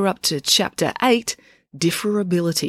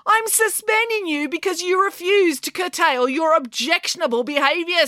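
high-pitched, heated justification; indignant teacher telling off a pupil; exasperated; 12 semitones higher than usual. Acted. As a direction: insist at the end of your patience. Angry teacher justifies suspension.

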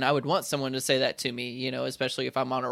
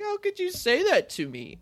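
much higher, hurt whiny protest; immature whine. Retold or imitated. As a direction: play the wounded kid. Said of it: "I was a little immature".

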